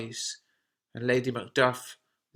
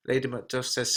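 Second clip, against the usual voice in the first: usual speaking voice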